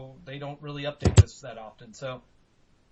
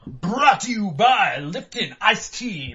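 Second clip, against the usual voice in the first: mock ad read; 4 semitones higher, 8 dB louder, slower than usual.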